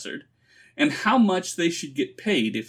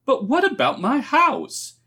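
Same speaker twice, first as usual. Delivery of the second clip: high, plaintive protest; 12 semitones higher, 4 dB louder than usual. A narrator's reading. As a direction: plead it in a much higher voice. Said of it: "he asked plaintively".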